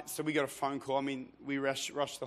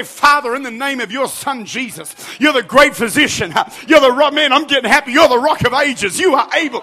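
loud, high, full-force declaration to God; impassioned preacher praying aloud; fervent prayer; 11 semitones higher, 21 dB louder than usual. Shown as a demonstration. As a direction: pray it out boldly at full volume.

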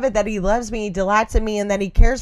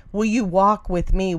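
usual speaking voice

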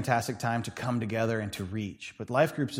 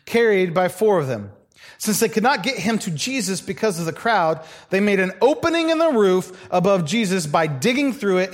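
louder, lifted Bible reading; scripture reading; 4 semitones higher, 4 dB louder than usual. Acted. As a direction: proclaim the passage to the congregation. Reads Mark chapter two.

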